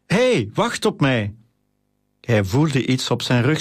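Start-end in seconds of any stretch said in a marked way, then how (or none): 0.0-1.3 drawn-out, higher-pitched call; storyteller's contained shout, slow and high. A narrator's reading. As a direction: call after friends running ahead. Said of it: "rende Titus achter hen aan en riep".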